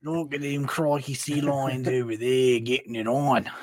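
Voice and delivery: lightly voiced Australian-tinged nature narrator; a loose crikey-style wildlife narration; Attenborough impression. Joking about the narrator voice with a mock Australian wildlife line. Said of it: "That's David Attenborough".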